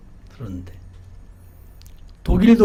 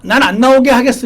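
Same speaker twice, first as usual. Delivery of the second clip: stubborn resolve; 6 semitones higher, 8 dB louder than usual. Retold or imitated.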